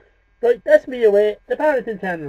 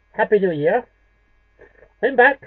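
ordinary delivery

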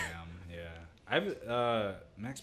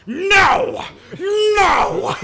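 much higher, louder anguished shout; imitated big no; 14 dB louder than usual. Friend reenacting Iceman's wall scene, anguished shouted no. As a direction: scream the no with everything. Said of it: "and he's like".

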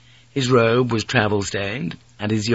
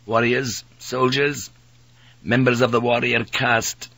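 slightly raised pitch, mild irritation; growing irritation; 2 semitones higher than usual. A narrator's reading. Priest explaining samurai to the pilot, mild irritation. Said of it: "said with growing irritation".

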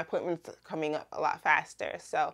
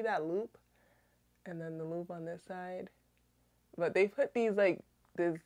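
talking with lip held open; showing teeth while speaking; finger pulling lip down; 7 dB quieter than usual. Real, not acted. Seen 0: Fingertip pulls lower lip down to show braces while talking.

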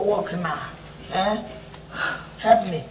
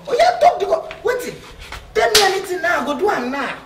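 excited laughing; 12 semitones higher, 3 dB louder than usual. Acted. Excited at a chance.

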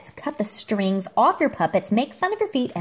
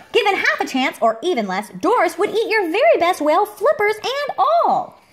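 much higher and louder, mock alarm; hammed-up warning; 9 semitones higher, 5 dB louder than usual. A narrator's reading. Reading to a child, a gleeful warning about Doris. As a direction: sell the danger with big relish.

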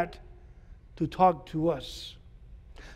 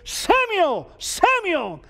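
high ringing call across the dark; 10 semitones higher, much slower than usual. Acted. God calling the boy Samuel, high and ringing. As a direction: call the boy's name from afar.

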